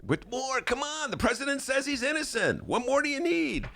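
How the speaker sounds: high, sarcastic mocking voice; mock outraged. Host mocking a column's Trump defence, high and sarcastic. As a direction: toss it off as a snide rhetorical jab. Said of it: "he's a funny".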